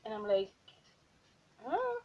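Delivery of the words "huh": very high-pitched questioning huh; incredulous. Retold or imitated. Worker told the week won't be paid, incredulous. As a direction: squeak out a baffled huh. Retells shock at not being paid.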